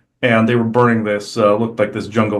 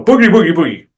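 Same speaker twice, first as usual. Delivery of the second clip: high, louder bogeyman cry dropping at the end; mock spooking; 7 semitones higher, 5 dB louder, faster than usual. Masked crew making someone look around, mock spooky cry. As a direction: play at startling someone with silly spook noises. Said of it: "So they go".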